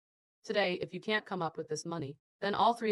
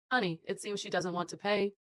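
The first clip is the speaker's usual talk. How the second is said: ordinary delivery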